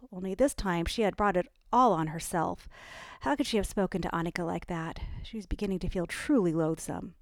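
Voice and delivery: plain reading voice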